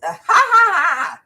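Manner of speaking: loud, high mocking ha-ha; a jeering high cackle, shouted; mocking laugh. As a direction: mimic them laughing right in your face. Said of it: "they laughed in our face".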